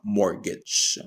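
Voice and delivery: MORTGAGE with a silent T, "MOR-gej"; correct pronunciation. Shown as a demonstration. Teacher showing how mortgage is pronounced, correct silent-T pronunciation. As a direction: say mortgage without any T sound. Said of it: "this is pronounced as mortgage".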